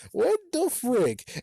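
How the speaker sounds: high, slow cry of disbelief; outraged disbelief. Real, not acted. Reacting to Slappy choking Shep, shocked.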